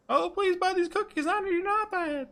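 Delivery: very high falsetto plea; high-pitched pleading salesperson; pleading cookie seller. Acted. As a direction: beg in a high put-on voice. Cookie seller pleading in visual novel.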